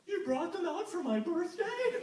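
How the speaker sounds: high, awed satyr voice on the edge of tears; a moved Grover asking if this is for him; teary satyr. Acted. Grover, high and teary, touched by a birthday surprise. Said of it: "Grover got teary-eyed".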